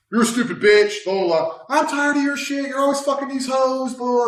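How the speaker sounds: hammed-up argument voice; mock girlfriend yelling. Arguing with girlfriend, imitated.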